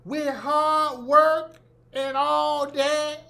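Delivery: high, drawn-out Southern preacher singsong. Retold or imitated. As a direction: stretch every phrase in a mocking Southern preacher chant.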